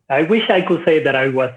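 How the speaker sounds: normal talking voice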